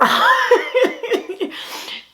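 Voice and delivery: high burst of laughter; long high laugh; embarrassed laugh. Real, not acted. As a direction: laugh out in disbelief. Reacting to kid's question.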